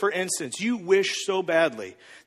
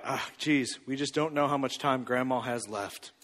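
low, hushed mock sorrow; mournful guilt trip; 6 semitones lower, 8 dB quieter than usual. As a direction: sigh it out softly to make them guilty. Acted guilt-trip about dying Grandma.

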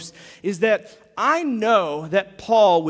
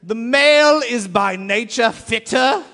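hammed-up booming ancient sage; Aristotle voice; 3 semitones higher, 8 dB louder than usual. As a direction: bellow it like a pompous old philosopher cracking up. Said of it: "in a very Aristotle voice".

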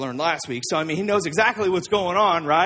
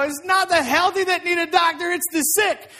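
high, raised acted call; projected mock yell, pitched up; Jesus; 7 semitones higher, 4 dB louder than usual. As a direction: voice it as an overheard yell from the window. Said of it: "he yells it out the window".

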